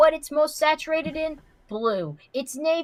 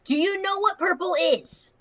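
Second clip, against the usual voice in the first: exasperated; 5 semitones higher, 5 dB louder than usual. Real, not acted. Heated color argument with Bronny.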